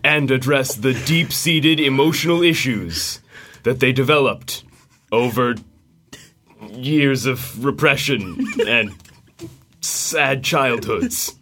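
dramatic movie trailer narrator. Acted. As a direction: epic trailer read, fighting back laughter. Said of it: "do their best trailer voice".

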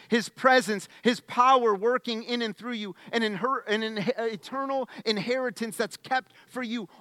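full-voiced sermon crescendo; preaching. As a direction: build the list with fire.

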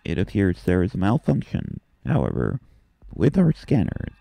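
flat, even AI voice scanning systems; ship computer voice. Acted. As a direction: speak like the ship's computer.

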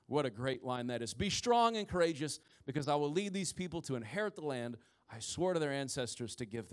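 reading the Lord's charge aloud to the congregation; scripture reading. Acted. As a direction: read it as a solemn scripture passage. Continues reading God's charge.

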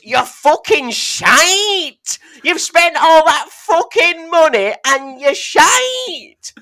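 high, loud, slow yelled abuse from an angry fan; hammed-up furious shout, way up high; mock furious yell. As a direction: bellow it out slowly like a raging northern fan. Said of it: "he looks at me and goes".

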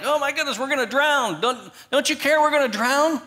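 voicing frightened disciples in a sinking boat; panicked disciples. Disciples in the storm crying to Jesus, mock panic. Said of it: "They're panicked".

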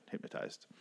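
breathy whispered "Hypnotized." tried out to oneself; trying the word. Shown as a demonstration. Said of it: "That's weird".

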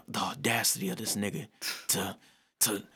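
under the breath, unvoiced; hushed, incredulous whisper. Real, not acted. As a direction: whisper it like a disbelieving aside. Incredulous aside about people's reaction.

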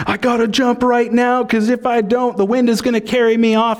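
high, loud, hammed-up British survival-show accent; Bear Grylls British accent. Preacher mimicking Bear Grylls to the congregation, hammed-up British accent. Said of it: "he says in that British accent".

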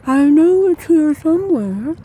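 distant, slow and muffled. Acted. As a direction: call it from another room. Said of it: "came her muffled voice".

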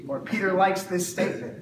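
normal talking voice

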